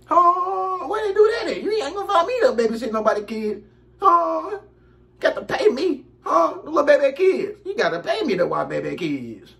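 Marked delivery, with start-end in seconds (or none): 0.1-1.6 high disbelieving shout, way up; incredulous. Real, not acted. Speaker's own incredulous outburst. 1.6-7.6 indignant refusal. Real, not acted. Still worked up, refusing.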